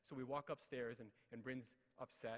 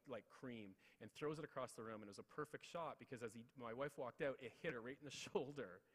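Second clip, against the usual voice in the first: ordinary talk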